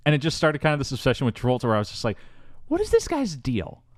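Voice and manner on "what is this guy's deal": baffled. Retold or imitated. Baffled by Travolta.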